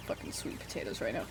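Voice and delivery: breathy whisper under the breath; whispered craving. Real, not acted.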